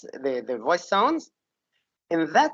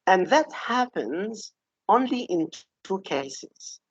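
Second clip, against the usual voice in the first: ordinary talk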